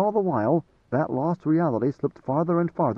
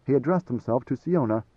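usual speaking voice

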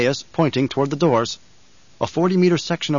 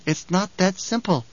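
put-on calm child Alia; 6 semitones higher, slower than usual. A narrator's reading. Alia voiced, slow.

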